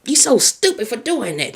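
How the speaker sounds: imitating a harsh self-put-down; mock self-scolding. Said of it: "I never once heard her call herself".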